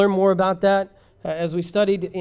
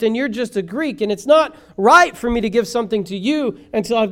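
preacher voicing a firm master, pitched up; emphatic preaching; 5 semitones higher, 6 dB louder than usual. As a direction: lay down the order forcefully, in a higher voice.

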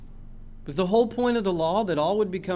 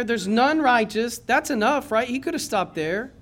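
ordinary talk, nothing marked

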